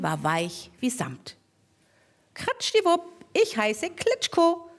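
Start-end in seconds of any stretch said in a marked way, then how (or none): 2.3-4.7 much higher, slow cheerful squeak; the mole proudly giving its name; cute mole voice. Acted. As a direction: introduce yourself like a tiny proud animal. Little mole introduces itself.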